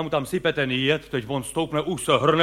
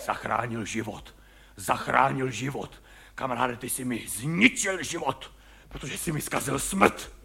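retold actor's reply; 5 semitones lower, 5 dB quieter than usual. Herec Vávra odpovídá.

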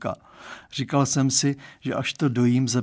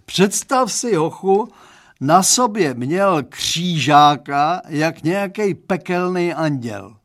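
slow, louder wailing outrage; howling complaint; 4 semitones higher, 5 dB louder, slower than usual. A narrator's reading. Father describing last boyfriend to the boy, wailing. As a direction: drag it out, appalled. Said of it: "zavil tak z nenadání".

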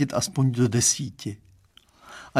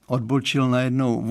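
ordinary delivery, nothing marked

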